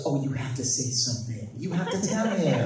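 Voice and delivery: lightly voiced mother urging her son; softly coaxing, retold as mom. Mom urging son to speak to the kid, coaxing. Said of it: "my mom goes".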